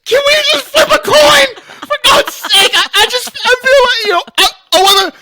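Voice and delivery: high, loud, wailing plea; hammed-up tearful squeal; mock panic. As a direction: play a whimpering coward begging. Acts Katie panicking at zombies.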